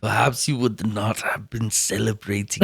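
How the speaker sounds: put-on soft, low German-accented Herzog; hushed German accent, keep it quiet; Herzog impression. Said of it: "He's always very, very like a whisper".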